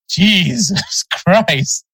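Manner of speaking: laughing. Real, not acted. Exasperated at the pronunciation, laughing.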